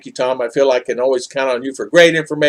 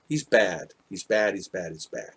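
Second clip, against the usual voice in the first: each "He's bad" softer until it dies away; fading repetition; 6 semitones lower, 11 dB quieter than usual. Telling the lieutenant about the trooper, fading away. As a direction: let the repeated line trail off to nothing.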